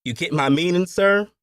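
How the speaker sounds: high, suggestive overseer voice; leering. A narrator's reading. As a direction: nudge the boss with a leering question. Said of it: "He leered".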